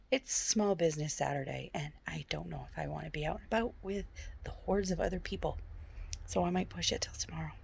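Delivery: ordinary talk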